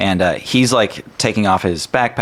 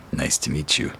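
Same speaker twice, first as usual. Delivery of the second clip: a softer, lower dwarf greeting; lightly voiced gruff dwarf; 4 semitones lower, 6 dB quieter than usual. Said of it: "That's a good dwarf voice".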